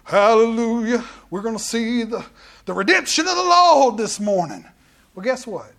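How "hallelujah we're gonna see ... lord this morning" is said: mock revival preacher, loud; put-on preacher voice. Pastor mocking a showy preacher voice before prayer. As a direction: ham up a showy pulpit voice. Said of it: "my voice down here real low and go".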